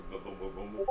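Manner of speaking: high, soft repeated "boom"; quick high button-press noises; imitated button beeps. Shown as a demonstration. Dad showing how digital phones dialed, imitated button sounds.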